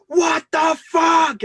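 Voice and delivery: drawn-out, high, loud scream; terrified screaming. Retold or imitated. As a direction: re-enact screaming awake in terror. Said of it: "'cause I was screaming".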